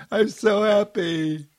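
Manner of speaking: high, joyful cry to the housetops; playful mock shout. Shown as a demonstration. Speaker shows housetop shouting for fun, playful high mock shout. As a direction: call it out high, without real shouting force. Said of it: "shouting to the housetops".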